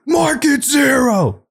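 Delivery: high, slow yelled movie quote; drawn-out mock shout of a movie line; Big Lebowski line. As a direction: bellow it like the bowling scene. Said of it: "I was doing the, um, the, uh, Big Lebowski".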